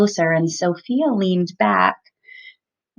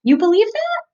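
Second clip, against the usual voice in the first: incredulous question; 11 semitones higher than usual. Real, not acted. Mother repeats incredulous question.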